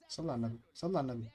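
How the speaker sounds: low and soft, reining yourself in; hushed self-calming. Real, not acted. Gamer calming himself after conceding, hushed self-calming. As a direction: say it quietly to settle yourself.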